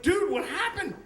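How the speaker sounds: high-pitched, bewildered mock outcry; acted-out shock; alarmed question. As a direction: demand to know what happened, voice jumping up. Shocked victim asks his friend.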